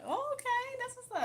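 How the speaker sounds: high squeaky mock voice; high falsetto. Sudden squeal reacting to comment.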